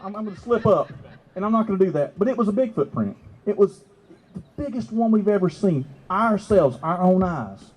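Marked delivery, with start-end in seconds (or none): none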